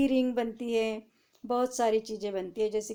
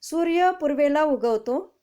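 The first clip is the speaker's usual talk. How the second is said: louder, carefully read example; reading the sentence aloud; 5 semitones higher, 6 dB louder than usual. Shown as a demonstration. Teacher reading a tense example to students, louder. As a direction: read it out to the class. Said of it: "आपको पढ़ के भी दिखाती हूँ".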